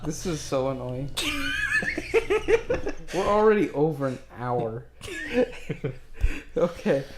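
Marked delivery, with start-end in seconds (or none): 1.1-3.1 high shrieking laugh breaking out; real burst of laughter. Real, not acted. Player cracking up at the tech trouble, a high shrieking laugh. 4.9-6.5 real laugh. Real, not acted. Player still laughing at the tech trouble, a high fading laugh.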